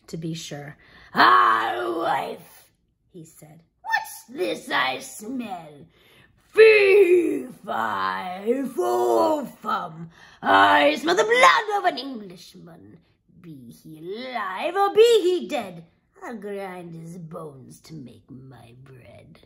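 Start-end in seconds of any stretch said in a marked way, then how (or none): 1.1-2.7 loud drawn-out greeting falling away; booming ogre. Acted. Ogre arrives home. 3.8-19.4 menacing ogre. Acted.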